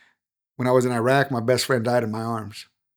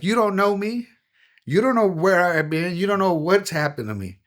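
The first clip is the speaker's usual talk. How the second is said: higher-pitched mock yell, not full volume; imagined yell; 7 semitones higher than usual. Homeless man answering a disgusted gas station clerk, a mild mock yell. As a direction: pitch it up like you're pushing back, but hold the volume. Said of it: "I wanted to yell at that person".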